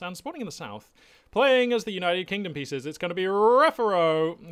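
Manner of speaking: loud, high ring-announcer build to a stretched-out name; match announcer. Acted. Caster introducing the southern player, big announcer voice. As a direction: belt out the player's name with a flourish.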